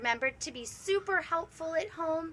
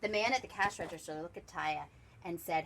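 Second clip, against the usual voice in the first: plain reading voice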